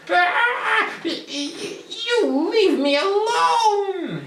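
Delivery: high, loud panicked goblin squeal; stammering goblin terrified; frightened squeak. Acted. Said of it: "he squeaked".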